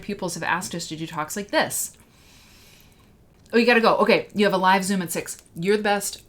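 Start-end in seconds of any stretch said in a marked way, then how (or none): 3.5-6.2 loud, bright rush of goodbyes; louder, higher quick farewell; excited send-off. Real, not acted. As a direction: call it out warmly, suddenly excited. Guest suddenly has to leave.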